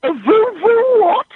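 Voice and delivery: high, slow disbelieving question; baffled. Real, not acted. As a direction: drag out the puzzled question. Confused by strange word.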